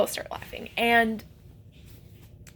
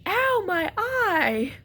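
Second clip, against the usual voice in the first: slow pained yelp; 9 semitones higher, much slower than usual. Retold or imitated. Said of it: "then I hear behind me".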